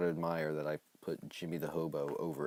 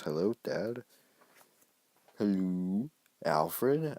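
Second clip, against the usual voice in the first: lightly voiced phone call; 3 semitones higher, 4 dB louder, much slower than usual. Voicing phone call characters.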